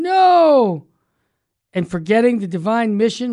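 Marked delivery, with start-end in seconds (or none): 0.0-0.9 emphatic No. Real, not acted. Preacher rejecting closed church.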